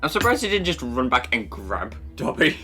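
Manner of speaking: usual speaking voice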